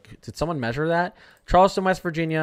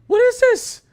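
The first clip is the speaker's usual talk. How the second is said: incredulous shriek; 10 dB louder, slower than usual. Real, not acted. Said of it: "ranting at website".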